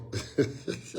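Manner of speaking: breathy chuckle before the story; quiet little laugh at his own story; soft laugh. Real, not acted. Speaker to audience, laughing softly before telling a story. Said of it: "I'm already laughing".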